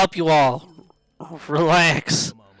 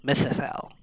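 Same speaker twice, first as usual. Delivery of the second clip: Mythothel whispered under the breath; breathy practice of Mythothel; whispered try; 5 dB quieter than usual. Real, not acted. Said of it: "That's a tongue twister".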